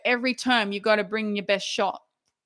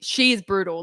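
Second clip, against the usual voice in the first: ordinary talk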